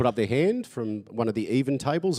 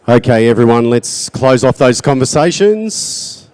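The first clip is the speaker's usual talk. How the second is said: calling out; 3 semitones higher, 14 dB louder than usual. Real, not acted. Calling room to attention.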